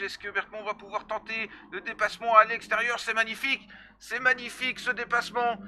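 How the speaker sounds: rising to an excited overtaking call; excited commentator. Real, not acted.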